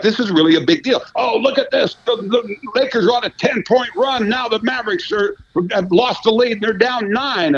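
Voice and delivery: high, breathless highlight call; mock sportscaster hype. Mocking sportscasters who give the score, hyped highlight voice. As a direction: ham up a TV sports anchor. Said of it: "go through the highlights like".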